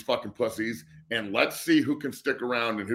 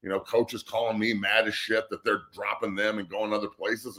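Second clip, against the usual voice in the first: ordinary delivery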